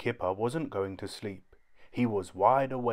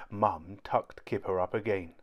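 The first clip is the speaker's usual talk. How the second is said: usual speaking voice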